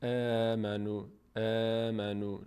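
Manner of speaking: slow آمنو with a drawn-out front æ; آمنو said slowly, mouth spread sideways; light thin vowel. Shown as a demonstration.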